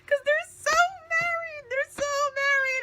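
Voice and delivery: high squeal, fangirling; high, gushing squeal; squealing delight. Real, not acted. Squealing over the couple.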